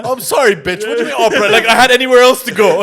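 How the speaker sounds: loud, high, mock-outraged protest; indignant outburst. Real, not acted. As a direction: defend yourself loudly against the teasing. Indignant protest at friend's story.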